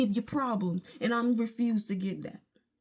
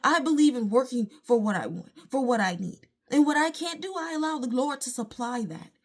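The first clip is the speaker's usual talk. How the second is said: ordinary talk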